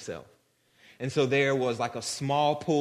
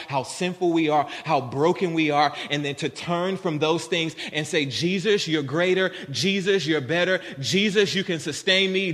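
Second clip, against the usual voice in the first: loud, higher-pitched impassioned preaching; 4 semitones higher, 6 dB louder than usual. Real, not acted. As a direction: preach it out with force, driving each phrase home. Preaches at full force.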